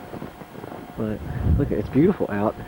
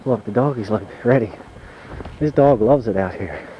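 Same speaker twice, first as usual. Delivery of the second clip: usual speaking voice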